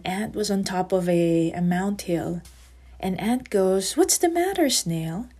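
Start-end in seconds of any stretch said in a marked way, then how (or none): 4.0-5.3 lightly voiced ant, higher pitch on the question; concerned story ant. Ant asks the crying snail what is wrong, concerned. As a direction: ask it higher, lifting into concern.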